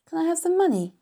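put-on high, childlike request; high-voiced child wanting something; young girl asking. A narrator's reading. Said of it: "asked Fern".

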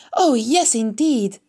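loud, high, delighted agreement; eager. A narrator's reading. Pig finally seeing his new friend, eager and excited. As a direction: burst out eagerly. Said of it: "asked Wilbur eagerly".

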